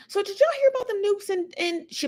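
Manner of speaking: high-pitched imitation of a thick Southern accent; high Southern-accented news creator impression. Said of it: "she's got a real thick accent".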